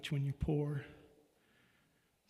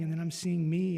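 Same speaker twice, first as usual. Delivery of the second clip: ordinary delivery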